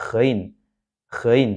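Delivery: Ghain with a throaty, buzzing gh; vibrating GHAIN. Shown as a demonstration. Teacher showing the letter's vibration, Ghain with a buzzed gh. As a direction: let the gh in ghain rasp at the upper throat. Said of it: "ada semacam vibrasinya atau getarannya".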